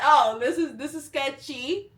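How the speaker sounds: high, loud 'oh', then rising in disbelief; wary shocked reaction. Retold or imitated. Acted reaction to a shady shop.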